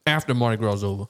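normal talking voice

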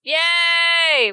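long, high drawn-out yay; cheering. Pretend. Player cheers as the food truck arrives, cheering.

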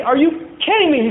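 high-pitched divine disbelief; exclaim, astounded by the insanity; incredulous outrage. Acted. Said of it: "He's laughing at the insanity".

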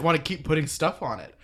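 normal talking voice

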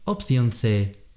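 ordinary delivery

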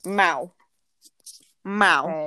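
imitating an odd meow in a human voice; weird cat meow. Owner showing how her cat meows, weird imitated meow. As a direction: do a weird cat's meow, spoken out. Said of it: "my cat meows really weirdly".

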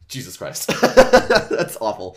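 loud laughing disbelief. Real, not acted. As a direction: laugh it out, horrified.

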